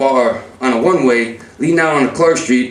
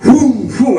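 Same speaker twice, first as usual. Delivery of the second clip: drawn-out, higher whoosh; imitated swing whooshes; 7 semitones higher, 3 dB louder than usual. Brother swinging at him, imitated whooshing. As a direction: voice each punch flying past. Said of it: "he swings".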